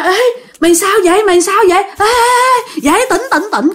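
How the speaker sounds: high, urgent acted alarm; panicked rousing. Girl trying to wake her fainted friend, panicked. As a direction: pitch it right up, frantically trying to rouse her.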